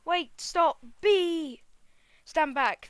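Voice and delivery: high, loud warning cry; alarmed shout. Acted. Human spots a bee and warns the others, alarmed shout. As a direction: yell a sudden warning.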